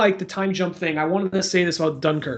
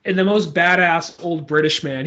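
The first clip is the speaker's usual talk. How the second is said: normal talking voice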